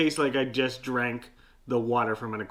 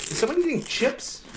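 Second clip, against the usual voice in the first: startled, irritated question; 10 semitones higher than usual. Acted. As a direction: pitch jumps up, annoyed. Eulogist interrupted by chip noise.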